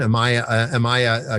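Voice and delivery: ordinary talk, nothing marked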